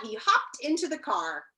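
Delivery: plain reading voice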